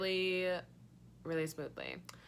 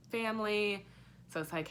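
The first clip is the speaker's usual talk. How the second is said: usual speaking voice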